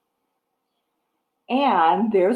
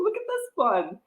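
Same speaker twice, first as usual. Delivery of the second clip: ordinary talk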